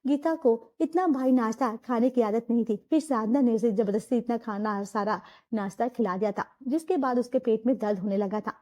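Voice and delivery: low, even storyteller voice; story narration. A narrator's reading. Narrator telling the family story, in a low even narration.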